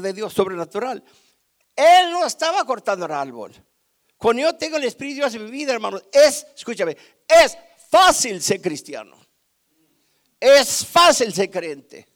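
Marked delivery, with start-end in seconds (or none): 1.7-12.1 loud, punched preacher's delivery; full-force preaching. Real, not acted. Preacher urges church to rely on the Spirit, full-force preaching. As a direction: hammer the key phrase with big pauses.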